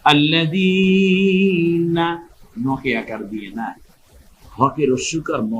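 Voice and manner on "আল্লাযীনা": আল্লাযীনা slow and higher, the ī stretched; এক আলিফ টান. Shown as a demonstration. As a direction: chant আল্লাযীনা with a long held যী. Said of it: "এক আলিফ টেনে বলবো". (যী টেনে সুর করে পড়া)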